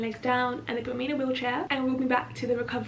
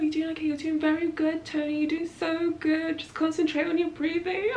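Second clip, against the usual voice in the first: higher, sustained coaxing voice; encouraging staff; 5 semitones higher than usual. Retold or imitated. Staff encouraging patient through egg collection, coaxing. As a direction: coax gently in a raised, sing-song pitch. Acting staff soothing her.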